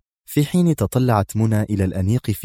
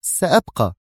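higher, decisive single word; firm; 5 semitones higher than usual. A narrator's reading. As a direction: cut him off with finality. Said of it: "قاطعته في حزم".

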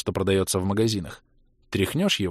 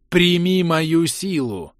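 slow, high, thundering proclamation; booming command; 6 semitones higher, much slower than usual. Acted. Mirta commands Kira to take her power, booming and slow. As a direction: boom it out like a dying queen. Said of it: "прогрохотала Мирта".

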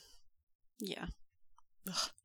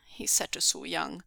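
usual speaking voice

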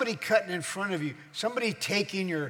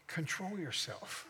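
soft instruction; 10 dB quieter than usual. Shown as a demonstration. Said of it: "Turn to someone and say".

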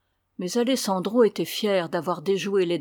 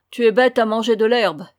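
loud, exasperated scolding; acted irritated shout; shouted insult; 3 semitones higher, 7 dB louder than usual. A narrator's reading. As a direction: berate a mate with a raised voice. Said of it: "criait-il à Jack".

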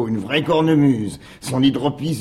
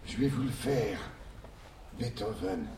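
hushed; 3 semitones lower, 13 dB quieter than usual. Acted. Hushed storytelling.

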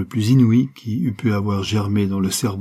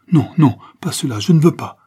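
louder and higher, a panicked refusal; horrified cry; 6 semitones higher, 8 dB louder than usual. Acted. Morales refusing the murder, a loud horrified cry. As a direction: cry out in horror, no no. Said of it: "s'écria Morales, devenu blême".

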